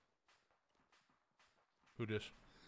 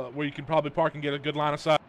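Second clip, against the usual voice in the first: normal talking voice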